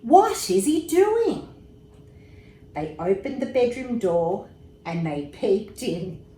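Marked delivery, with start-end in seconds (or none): 0.0-1.5 high, loud bewildered question; puzzled. A narrator's reading. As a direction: ask it baffled. Parents puzzled by David.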